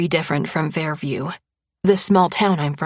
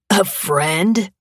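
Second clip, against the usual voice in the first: scoffing laugh. A narrator's reading. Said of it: "Aaron laughs".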